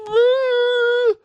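very high, sustained fake sob; one long exaggerated cry; mock crying wail. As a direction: wail on demand like a bad crier. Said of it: "dude cries on demand".

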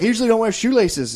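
mock Irish lady. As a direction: friend imitates the Irish lady explaining her shoes.

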